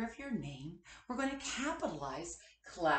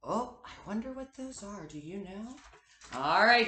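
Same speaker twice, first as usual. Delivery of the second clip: ordinary talk, nothing marked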